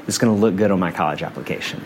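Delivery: put-on flat, quiet, fast dead-serious kid; deadpan. Nine-year-old explaining her schedule, deadpan and matter-of-fact. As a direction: rattle it off softly, totally serious. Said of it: "deadpan, being dead serious".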